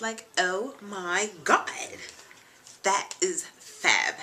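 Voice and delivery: put-on slow, drawn-out Valley Girl accent. Shown as a demonstration. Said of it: "you mean if she talks like this?".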